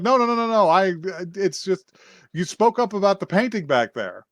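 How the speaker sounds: flustered pleading. Retold or imitated. Follower stops girl calling the police, flustered pleading. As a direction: stammer out an acted, frantic explanation.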